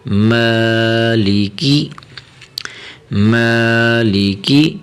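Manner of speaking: مالی with the first vowel held long; stretched long vowel. Shown as a demonstration. Teacher showing the alif-length stretch, long "aa" in مالی. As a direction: draw out the "aa" in مالی for one alif. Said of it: "اسے تھوڑا سا کھینچنا ہے".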